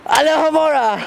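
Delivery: wizard casting a spell, high; high spell cry. Pretend.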